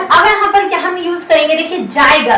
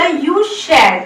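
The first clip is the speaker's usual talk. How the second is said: mock wrong answer; much slower than usual. Shown as a demonstration. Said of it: "बिल्कुल गलत।".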